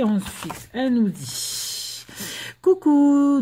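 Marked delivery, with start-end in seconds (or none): 2.6-3.4 high, loud drawn-out greeting; cheery greeting. Acted. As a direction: sing out the card's hello. Reading aloud a card's greeting.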